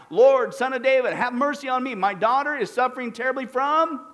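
a woman's urgent cry for help. Acted. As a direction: cry out to be heard, pleading. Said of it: "crying out".

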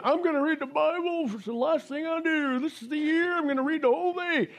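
high put-on voice of a keen churchgoer; eager resolution maker. Preacher mocks a churchgoer's resolution in a high voice. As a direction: play the over-eager new believer making a vow.

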